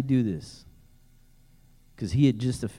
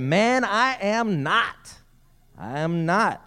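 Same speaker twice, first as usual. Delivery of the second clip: high, drawn-out protest; acted scared denial; 7 semitones higher, much slower than usual. Peter denying he is one of them, acted scared. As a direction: stretch out the denial, playing a rattled man. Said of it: "Sounds like Peter's getting scared".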